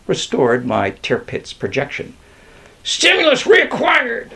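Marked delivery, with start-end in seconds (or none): 2.8-4.3 very high excited yell; high-pitched bird pilot's cry. A narrator's reading. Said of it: "I yelled".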